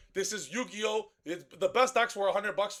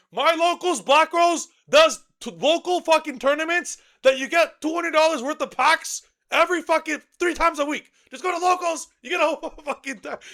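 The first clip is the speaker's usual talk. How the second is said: yelling at complainers about budget decks, venting his frustration; upset rant; 10 semitones higher, 10 dB louder than usual. Real, not acted. Ranting to complainers about budget decks, much louder and higher. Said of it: "I was just a little upset there".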